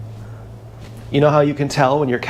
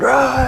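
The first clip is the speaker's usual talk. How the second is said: mock scare roar; 11 semitones higher than usual. Scaring the cat, chasing it.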